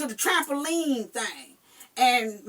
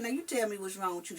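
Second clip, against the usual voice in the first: quiet coaxing; 6 semitones lower, 11 dB quieter, faster than usual. Retold or imitated. Mother quietly questioning child.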